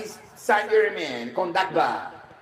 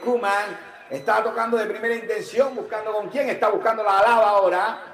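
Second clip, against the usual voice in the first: ordinary talk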